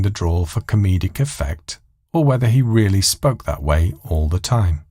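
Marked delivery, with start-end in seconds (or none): none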